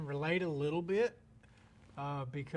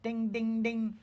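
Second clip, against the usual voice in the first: high, louder bell chime ding ding ding; bell ding sound; 7 semitones higher, 4 dB louder, slower than usual. Pretend. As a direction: ring out like a notification bell. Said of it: "Ring the bell".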